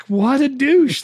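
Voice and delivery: high, loud gleeful outburst; delighted exclaim. Retold or imitated. Reacting to Cruise's sarcasm, high and gleeful. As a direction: blurt it out high with glee.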